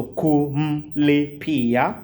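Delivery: "kumlipia" drawn out slowly, each of ku-mli-pi-a spoken apart; syllable by syllable. Shown as a demonstration. Swahili teacher showing students how to say it, slow and syllable by syllable. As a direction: spell "kumlipia" out slowly in sounds. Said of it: "five syllables".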